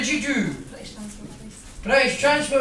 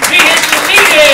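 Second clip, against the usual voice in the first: bigger shout; 4 semitones higher, 10 dB louder than usual. Real, not acted. Comic escalates call to crowd.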